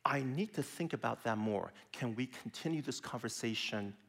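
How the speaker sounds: soft, calm, measured; calm model sentence. Shown as a demonstration. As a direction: say it gently, to defuse. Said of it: "learn this sentence".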